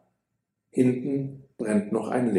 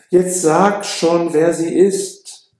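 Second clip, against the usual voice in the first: slow, high, louder imploring; 6 semitones higher, 5 dB louder, slower than usual. Acted. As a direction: urge the friend to finally tell. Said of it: "beschwört ihn Romeo". (langsam, höher und lauter beschwörend)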